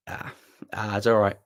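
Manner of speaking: ordinary talk, nothing marked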